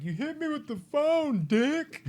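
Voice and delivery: high, loud, whiny complaint; hurt Stu. Retold or imitated. Stu whining at Billy after the phone hit, high whine. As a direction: whine it like a kid who got smacked. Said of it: "When he's like".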